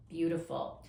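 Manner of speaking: ordinary delivery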